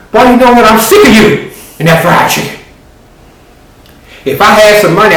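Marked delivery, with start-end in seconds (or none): 0.1-2.6 raised, higher scolding mom voice; fed-up mama snapping at her boy; exasperated mother telling off her son. Acted.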